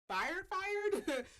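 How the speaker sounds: "fired, fired" pitched high and loud; emphatic repeat. Real, not acted. Stressing she's not fully let go, emphatic "fired, fired". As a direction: punch both words of "fired, fired".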